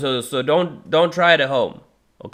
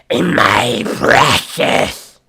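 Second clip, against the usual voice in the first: very slow, loud, raspy Gollum croak, cleaner take; 10 dB louder, much slower than usual. Shown as a demonstration. Vocalist retrying Gollum's voice after too much saliva. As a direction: drag out each word like Gollum. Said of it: "I had too much saliva in my mouth".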